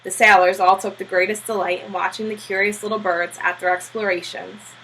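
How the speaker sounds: ordinary delivery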